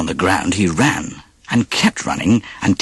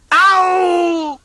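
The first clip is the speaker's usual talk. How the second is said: high sharp yelp; yelp of pain. Acted. Wolf whacked by the pig, high yelp. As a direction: cry out as the brush whacks you. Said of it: "the wolf howled in pain".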